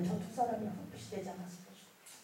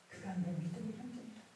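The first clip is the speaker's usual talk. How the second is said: hushed, much softer divine pronouncement; voice of God; 13 dB quieter than usual. Retold or imitated. God reveals something astonishing to the believer, hushed voice of God. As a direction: voice God speaking very quietly. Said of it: "하나님이 기가 막힌 말씀을 하셨어요".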